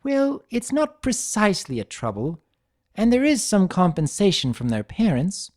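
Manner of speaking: high, elegant lady voice, hedging; Rarity, refined and evasive. A narrator's reading. Rarity hedging to Twilight in her refined voice. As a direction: lift into Rarity's genteel register.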